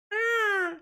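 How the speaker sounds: high squawking seagull "Ah"; seagull cry. Shown as a demonstration. A streamer squawks like a seagull for chat. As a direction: squawk it like a gull at the beach. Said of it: "what's the noise that Siegel make".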